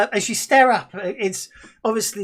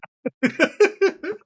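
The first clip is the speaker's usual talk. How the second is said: laughing; 14 semitones higher, 3 dB louder than usual. Real, not acted. GM laughs at player joke.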